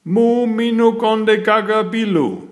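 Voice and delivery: high, loud alien gibberish; alien greeting. A narrator's reading. Little green man welcoming the visitors, high alien voice. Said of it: "said one of the little green men".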